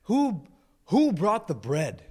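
loud, slow demand to the group; loudmouth Peter. Acted. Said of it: "He's the loudmouth".

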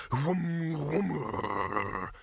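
whoom falling into a long rumble; hum, then let it drop and roll; droning whoom. Acted. Machine about to fire, droning whoom.

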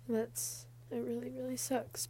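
tearful, choked-up quiet voice; holding back tears, words going small; blubbering. Real, not acted.

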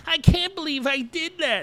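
high-pitched ghostly lament; imitating a mortified ghost lady; mock distraught wail. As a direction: wail it in hammed-up regret, way up high. Ghost wails in regret next morning.

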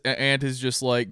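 normal talking voice

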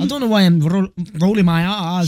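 Scottish accent with rolled R's; overdone Scottish, rolling the R's. Shown as a demonstration. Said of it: "my Scottish is quite good".

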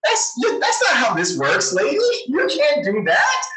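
high, outraged mock telling-off; indignant scolding. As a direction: wag a finger at her, half laughing. Mock scolding the resigning mayor.

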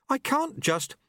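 a startled novice stammering; nervous boy. A narrator's reading. Apprentice answers his master, nervous. Lobsang voiced.

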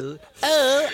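squeaky high "Oh, oh"; hammed-up high-pitched moan; high mock moan. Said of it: "I'd be like".

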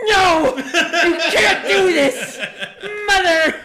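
put-on high shrieking child tantrum. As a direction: scream it while being spanked. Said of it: "He's like".

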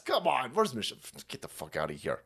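ordinary talk

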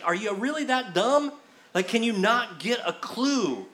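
high-pitched mock disbelief; incredulous exasperation. Acted exasperated Jesus chiding disciples.

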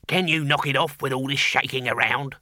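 squeaky little creature voice; squeaky demon. A narrator's reading. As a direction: squeak it out as a tiny grumbling demon. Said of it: "squeaked the demon".

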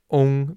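"ong" with a rounded O sound in place of eng; "ong" the way it is said in Taiwan; southern ong ending. Shown as a demonstration. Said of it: "more of an O sound".

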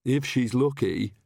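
slow, understated gloomy remark; mild gloom. A narrator's reading. Agnes on the baby's nickname, mildly gloomy. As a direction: add a touch of gloom, taking it slowly. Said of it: "said Agnes gloomily".

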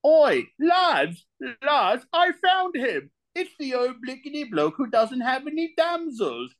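very high, hammed-up British lad; British lad calling out to his mates, then cackling; British accent. Shown as a demonstration. Guest demonstrating accents to hosts, exaggerated high British accent. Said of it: "do you wanna hear the British accent now".

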